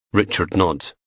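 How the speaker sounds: usual speaking voice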